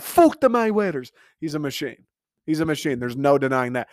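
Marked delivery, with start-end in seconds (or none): 0.0-1.1 high mock Irish taunt; Conor McGregor impression. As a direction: toss it out in a hammed-up Irish accent. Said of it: "How many iconic sayings does this dude have".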